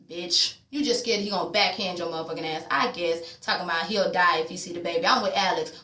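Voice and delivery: usual speaking voice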